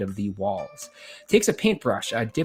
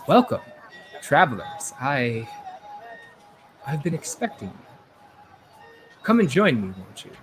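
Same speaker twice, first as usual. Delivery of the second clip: mysterious voice from the shadows; 2 semitones higher than usual. Acted. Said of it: "you hear a voice, uh, from the shadows".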